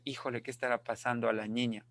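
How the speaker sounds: soft, quick musing to oneself; hushed, hurried puzzling over the girl; quiet wondering. Pretend. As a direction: wonder it under your breath, fast. Teacher voices own wondering.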